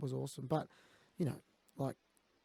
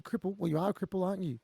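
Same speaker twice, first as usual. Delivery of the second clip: mocking quote; 6 semitones higher, 4 dB louder than usual. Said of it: "people go to me".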